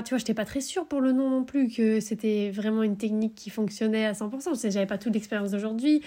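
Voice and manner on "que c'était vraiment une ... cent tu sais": ordinary delivery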